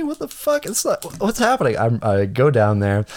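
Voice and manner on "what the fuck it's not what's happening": shocked squeak, much higher; panicked disbelief at hearing his name; alarmed surprise. Retold or imitated. Suddenly hears his name called.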